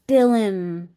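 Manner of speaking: normal talking voice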